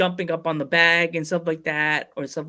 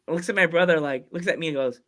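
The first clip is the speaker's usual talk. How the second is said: ordinary talk, nothing marked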